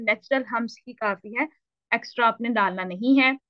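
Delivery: usual speaking voice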